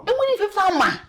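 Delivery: high, loud scolding mother; outraged mother yelling high; scolding shout. Acted. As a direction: snap at the son in alarm.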